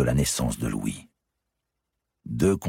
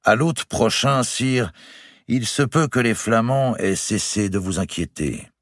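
raised, higher-pitched reply; speaking up; 8 semitones higher than usual. A narrator's reading. Astrologer answering the king about the Flemish war, speaking up. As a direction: lift the voice so the king hears. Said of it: "Puis plus haut".

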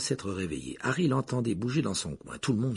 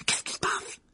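whispered worry. Acted. Dark train, Ron worried.

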